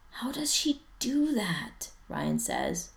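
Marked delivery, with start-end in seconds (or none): 0.1-1.9 higher, slow whisper; hushed under-breath whisper; under his breath. Acted. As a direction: whisper it, slowly, to a friend. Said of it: "under his breath".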